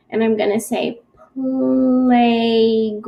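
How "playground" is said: slow, drawn-out playground; stretched out slowly. Shown as a demonstration. Teacher to class, playground drawn out slowly. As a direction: stretch playground like a rubber band. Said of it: "stretch it out like I'm pretending like I'm stretching a rubber band".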